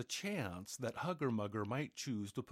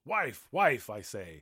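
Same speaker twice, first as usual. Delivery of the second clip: giant bellowing; 10 dB louder than usual. A narrator's reading. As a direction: shout across the house, still smarting. Giant bellows for his wife.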